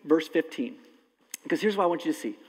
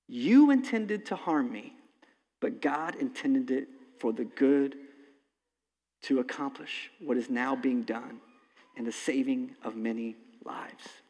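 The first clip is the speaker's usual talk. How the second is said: slow, falling, ending in a whisper; fading to a whisper; 3 semitones lower, slower than usual. Acted. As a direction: let Joseph's words sink to a hush. Reading Joseph's climactic words.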